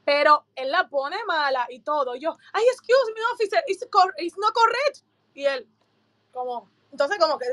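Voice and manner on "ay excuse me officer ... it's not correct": high-pitched anxious interjection to an officer; politely object, pitched well up; protesting. Retold or imitated. Interrupts officer to protest his marking.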